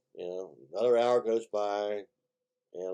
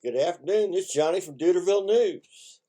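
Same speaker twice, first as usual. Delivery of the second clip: bright, louder, higher anchorman sign-on; mock news anchor; 8 semitones higher, 7 dB louder than usual. Local newsman opening his Duderville News joke segment, hammed-up anchor voice. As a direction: greet the viewers like a TV host.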